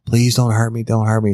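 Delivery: high-pitched, urgent begging; freaked-out pleading. Retold or imitated. Woman begging her captor not to hurt her, freaked out. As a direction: imitate a terrified woman begging for mercy. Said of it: "And she's freaking out".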